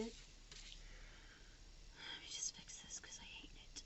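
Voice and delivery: soft breathy whisper. Real, not acted. Vlogger fixing a sticker she hates, whispered. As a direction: mutter it to yourself.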